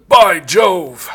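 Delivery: loud mock-British exclamation; hearty old-film British outburst; old British guy. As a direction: cry it out like an old British movie gent. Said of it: "old, uh, Scot, British guy going".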